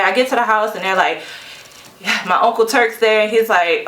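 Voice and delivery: usual speaking voice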